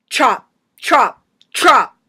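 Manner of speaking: three loud, falling blows; sharp shouts. Acted. Poet acting out the willow tree being cut, sharp shouts. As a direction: shout each word like an axe stroke. Poem acts out the felling.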